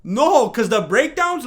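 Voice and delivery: emphatic protest. Real, not acted. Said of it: "emphatic protest".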